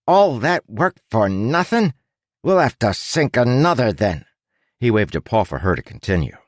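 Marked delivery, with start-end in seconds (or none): 0.1-4.2 high, dramatized demonstration of a villain's line; acting out Laggart. A narrator's reading. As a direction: perform the line with actorly emphasis. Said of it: "He said".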